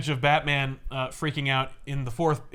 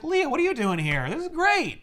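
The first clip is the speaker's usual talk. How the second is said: much higher, chipper greeting; mock cheerful Batman; 12 semitones higher than usual. Batman greets Leah inside his mind, high and chipper. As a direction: play Batman oddly delighted.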